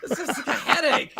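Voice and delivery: high-pitched, laughing incredulity; laughing disbelief. Real, not acted. Host reacting to an endless movie critique, laughing incredulously. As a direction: squeal it through laughter. Joking over a long video.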